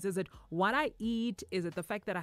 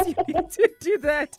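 higher and louder through a laugh; 7 semitones higher, 10 dB louder than usual. Pretend. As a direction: break into giggles mid-advice. Pretend doctor dissolves into laughter.

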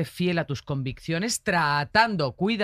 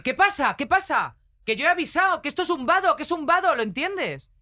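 higher, louder mock rant of an irate driver; retold driver's raised-voice rant; angry driver; 9 semitones higher, 5 dB louder than usual. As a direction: play the indignant driver shouting out his window.

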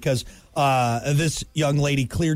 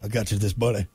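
low, lazy Nicolas Cage impression; fake Nicolas Cage; 8 semitones lower, faster than usual. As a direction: mumble it like Nicolas Cage in Con Air. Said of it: "That fake Nicolas Cage accent".

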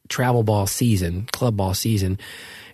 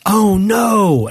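high, loud mock-dismayed cry; dramatic wail of dismay, retold; 8 semitones higher, 5 dB louder than usual. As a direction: imitate a wife's sudden alarm. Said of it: "So my wife says".

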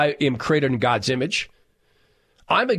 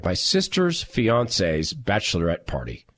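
lower, deliberate re-reading; slow, puzzled repeat; 6 semitones lower, slower than usual. Real, not acted. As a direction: spell out the phrase slowly, word by word. Repeats odd phrase slowly to check.

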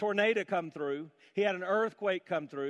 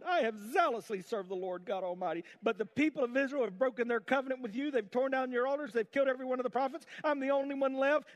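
whiny self-pity; 8 semitones higher than usual. Acted. Preacher mocks Elijah's complaint to God, in a high whine.

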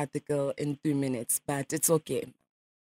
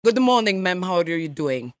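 a light mock Russian accent, nasal ma'am; radio prank caller in a lightly faked Russian accent; put-on Russian accent. Prankster calls a stranger as a Russian journalist, mock Russian accent. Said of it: "Can I be Noma Raja?".

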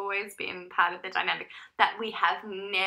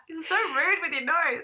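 high and loud, still laughing; laughing complaint; 8 semitones higher, 7 dB louder than usual. Real, not acted. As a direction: tease her through laughter.

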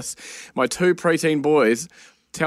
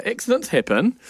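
"Accidents happen." said higher and bright, first take; bright reassurance; 8 semitones higher than usual. Retold or imitated. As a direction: chirp "Accidents happen." to brush it off. Partner reassures child, repeats.

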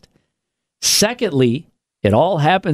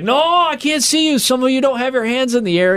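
much higher, pushy hype-man voice; hammed-up emcee scolding the crowd; mock concert host; 12 semitones higher than usual. Concert host nagging the crowd, high and pushy.

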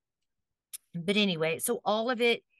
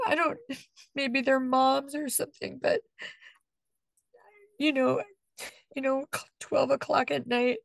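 real tearful voice, higher, halting with long pauses; holding back tears; 6 semitones higher than usual. Real, not acted. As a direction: struggle not to cry as you speak. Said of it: "I'll try not to cry".

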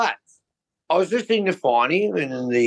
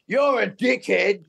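higher-pitched, drawn-out heckle; mock yell; 9 semitones higher, slower than usual. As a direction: call it out like a footy fan, without real volume. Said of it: "yell out".

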